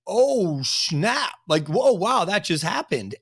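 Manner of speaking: high-pitched imitation of stunned onlookers; mock shocked reaction. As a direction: play the gossiping crowd's astonishment, pitched high. Voices whole industry reacting to firing.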